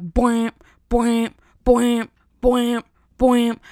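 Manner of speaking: loud sung boing beat, imitating the song; loud mock song beat. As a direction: belt out boing sounds like the song's beat. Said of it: "then I'm like".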